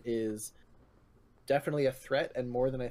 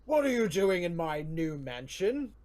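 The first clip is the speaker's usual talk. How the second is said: slow, higher-pitched monster bellow; bellowing oni; 4 semitones higher, 3 dB louder, slower than usual. Acted. Oni confronting intruders in its mansion, slow bellow. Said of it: "this creature comes to the top of the stairs and kind of goes".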